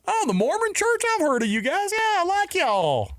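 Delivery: very high fake-sweet Southern squeal; five octaves up. Southerner feigning love for Mormons, sky-high pitch. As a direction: shoot the voice way up into falsetto, sticky sweet. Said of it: "when they go up five octaves".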